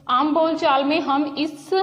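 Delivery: ordinary talk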